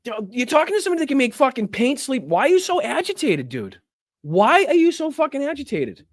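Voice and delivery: high, pleading incredulity; exasperated disbelief. Real, not acted. Streamer asking a debater why he is agitated, exasperated. Berating the man in clip.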